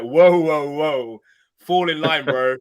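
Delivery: loud, high "whoa, fall in line" as a scolding fan; mock outraged shout. Re-enacting his own reaction to Sonny, loud mock shout. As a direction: bark it like a fan telling a rebel to obey. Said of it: "you saw my reaction".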